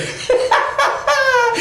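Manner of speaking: very high, gleeful cackle; Brer Fox's cackle. Acted. Fox delighted with his boiling plan, high cackle. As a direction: cackle high over your wicked plan.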